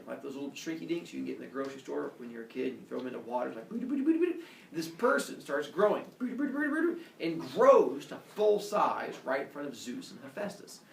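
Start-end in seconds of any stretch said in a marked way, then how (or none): 3.7-4.4 high, bubbly cartoon sound effect; playful rising "boo-doo" noises; imitated growing noise. Storyteller imitating a shrinky dink growing, high playful noise. 6.2-7.0 high, bubbly cartoon sound effect; playful rising "boo-doo" noises; imitated growing noise. Storyteller imitating a shrinky dink growing, high playful noise.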